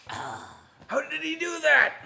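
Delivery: very high, slow astonished question; baffled. Retold or imitated. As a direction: gasp out how. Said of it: "we're like".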